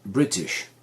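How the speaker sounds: British with short, lax i sounds. Shown as a demonstration. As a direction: say British low and short, stressed on BRIT. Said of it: "le I de British".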